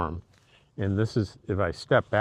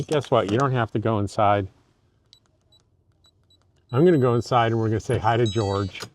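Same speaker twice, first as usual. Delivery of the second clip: ordinary delivery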